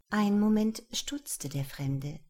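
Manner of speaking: plain reading voice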